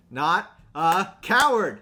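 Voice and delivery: slow hammed-up insistence; emphatic. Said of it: "Starscream saying".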